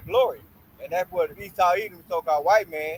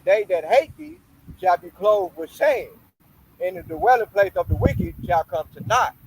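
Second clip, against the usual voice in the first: preaching at full force; 2 semitones higher, 4 dB louder, slower than usual. Acted. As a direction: proclaim the verse, shame stretched slow. Rereads verse from the top.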